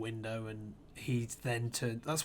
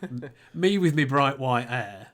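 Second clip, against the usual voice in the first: laughing; 2 semitones higher, 10 dB louder than usual. Real, not acted. Laughing about mismatched family.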